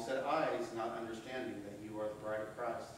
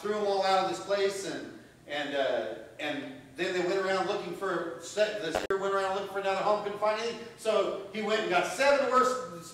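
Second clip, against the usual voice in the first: loud, rising preacher's fervor; preaching at full force; 7 semitones higher, 10 dB louder than usual. Acted. Preacher driving the point home to the congregation, loud and fervent.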